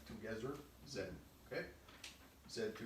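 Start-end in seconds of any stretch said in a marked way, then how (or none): 0.0-0.5 put-on soft German-accented "Together", th as z; quiet "togezzer" in a German accent. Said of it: "he says it with a German accent".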